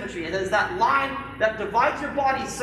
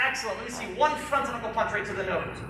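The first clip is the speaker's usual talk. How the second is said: normal talking voice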